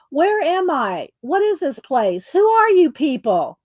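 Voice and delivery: confused dwarf. Acted. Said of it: "the dwarf that fell through".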